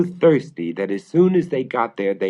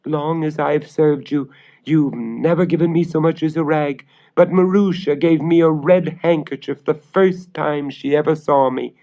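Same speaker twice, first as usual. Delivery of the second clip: put-on servant girl voice; 7 semitones higher, 4 dB louder than usual. A narrator's reading. Servant girl answers the witch.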